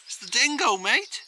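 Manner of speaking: put-on high, bouncy Australian accent. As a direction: announce it like a cheery Aussie bloke. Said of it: "I can't do the accent".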